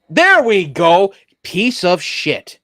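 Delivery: slow, loud, fed-up grumble; frustrated. Real, not acted. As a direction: curse at the broken computer. Host angry at the computer.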